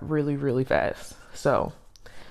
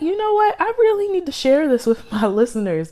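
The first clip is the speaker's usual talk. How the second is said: excited inner voice; 11 semitones higher, 3 dB louder, faster than usual. Retold or imitated. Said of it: "I was like".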